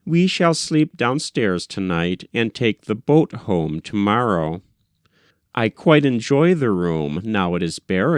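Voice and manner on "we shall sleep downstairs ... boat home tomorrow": ordinary delivery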